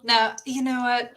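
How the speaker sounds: normal talking voice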